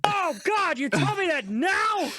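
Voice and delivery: high-pitched shocked disbelief; surprised. Real, not acted. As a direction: squeak out the shock. Shocked at the news.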